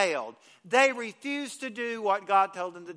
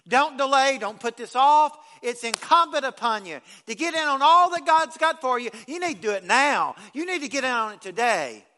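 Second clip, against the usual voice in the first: louder, higher urgent preacher's push; preaching at full force; 4 semitones higher, 8 dB louder than usual. Acted. Preacher urging the congregation not to delay, loud and fervent. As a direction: drive the urgency home from the pulpit.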